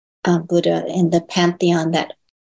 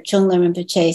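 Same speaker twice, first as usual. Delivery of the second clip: ordinary talk, nothing marked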